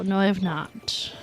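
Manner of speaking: ordinary talk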